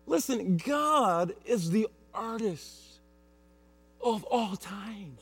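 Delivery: softly, slowly stress the awe; soft preaching. Acted. Pastor to congregation, soft and slow. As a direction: draw them in quietly.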